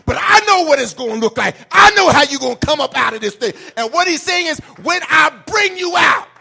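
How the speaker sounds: shouted gospel climax, fast at the end; full-force preaching. Acted. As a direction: whoop it to the congregation.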